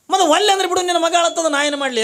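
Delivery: high, loud acted wife's comeback; fed-up wife in a comic quarrel; exasperated retort. Wife snaps back at refusing husband.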